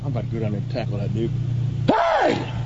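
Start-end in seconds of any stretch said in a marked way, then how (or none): 1.9-2.5 sudden high yell. Acted. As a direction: burst in with a shout. Character bursts in yelling.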